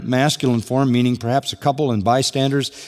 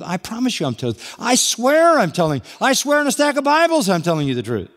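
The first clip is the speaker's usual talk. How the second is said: pleading, climbing much higher with each oath; mock liar swearing ever more desperately; protesting liar; 9 semitones higher than usual. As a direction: pile up the oaths, higher and higher. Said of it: "this is pretty much how humans behave".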